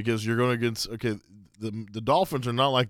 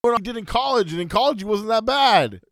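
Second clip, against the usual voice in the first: high-pitched, insistent pushback; heated defence; 8 semitones higher, 5 dB louder than usual. Real, not acted. Co-host defends a player's college tape, heated and high. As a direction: argue back in a raised, pleading pitch.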